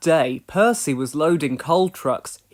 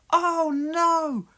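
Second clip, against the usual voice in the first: very high cry of alarm; skidding toward the buffers; alarmed hoot; 12 semitones higher than usual. A narrator's reading. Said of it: "hooted Percy".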